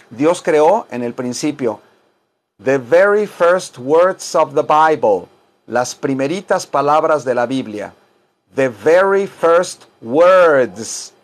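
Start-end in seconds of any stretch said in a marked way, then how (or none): 2.6-5.3 slow, deliberate model sentence; measured language-class modelling; slow teaching diction. Shown as a demonstration. Teacher models English slowly. 8.5-11.1 very slow, drawn-out English words; louder on a long "words"; slow teaching diction. Shown as a demonstration. Teacher models English slowly.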